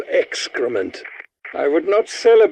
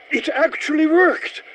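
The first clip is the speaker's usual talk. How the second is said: surprised delight; 12 semitones higher than usual. Acted. Scientist astonished that his device actually worked, high and amazed. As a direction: pitch leaps up high in amazed disbelief. Device finally works after trying.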